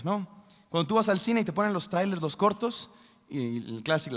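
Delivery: ordinary talk, nothing marked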